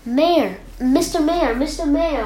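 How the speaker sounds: calling, name after name. A narrator's reading. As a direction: hail someone far below. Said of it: "Horton called".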